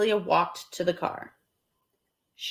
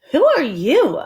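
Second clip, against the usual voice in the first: louder, higher; surprised question; 6 semitones higher, 7 dB louder than usual. Acted. Amelia Bedelia surprised by the boys, loud. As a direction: ask in surprise. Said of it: "she asked".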